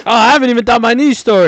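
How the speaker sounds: high, loud, rushed outburst; sudden loud realisation. Real, not acted. Co-host remembers his unread news story, loud outburst. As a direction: burst in fast and high. Interrupts, remembering own segment.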